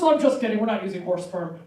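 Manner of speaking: ordinary talk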